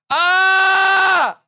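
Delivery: high, loud yelp of pain; mock pain yell. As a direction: yelp like you just got nicked. Scissors cut joke, yelling.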